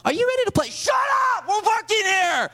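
very high, strained shouting; hammed-up high-pitched shout; mock coach yell. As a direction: scream orders like an overwrought coach. Coach yells at team, retold.

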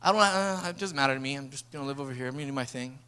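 low, trailing-off shrug of a voice; detached, low and dropping off; indifferent. Acted. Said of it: "but not indifferent".

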